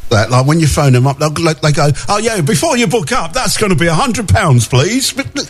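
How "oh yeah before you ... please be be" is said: higher sing-song mock receptionist. Host mocking the dentist's receptionist, higher mock voice. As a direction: parody the pricey dentist on the phone, higher.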